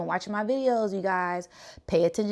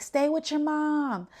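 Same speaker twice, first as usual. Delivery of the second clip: pleading with the fawns; 8 semitones higher than usual. Retold or imitated. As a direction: gently urge the little deer to stay close. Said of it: "I, I'm like".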